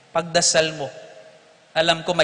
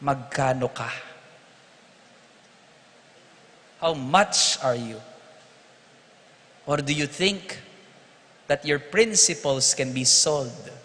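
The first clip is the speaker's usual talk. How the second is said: slow probing question; 2 semitones lower, slower than usual. Shown as a demonstration. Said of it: "tanungin mo ang katabi mo".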